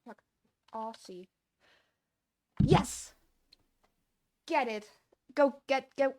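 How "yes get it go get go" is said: loud, higher victory yell; triumphant shout. Real, not acted. Coder whose code finally works, triumphant shout. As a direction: cheer like you just won.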